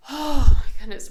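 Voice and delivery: loud exasperated sigh into the words; retold sigh of exasperation. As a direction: huff out a big overwhelmed sigh. Retells being frazzled by confusion.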